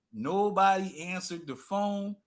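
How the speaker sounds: drawn out, each word weighted; slow, heavy repetition; slow emphatic repeat. Shown as a demonstration. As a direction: let "Nobody" sink in slowly. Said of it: "repeated for emphasis".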